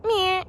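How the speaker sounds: one high grating squawk; grating blue jay squawk. Shown as a demonstration. Guide imitates the sound she dislikes, high grating squawk. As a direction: give the jay's grating call. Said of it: "sort of a grating".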